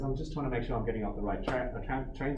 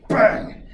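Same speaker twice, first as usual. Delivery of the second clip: imitated bang; 8 semitones higher, 10 dB louder than usual. Retelling man pushing into him.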